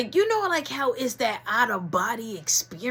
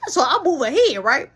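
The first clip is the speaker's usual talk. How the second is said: usual speaking voice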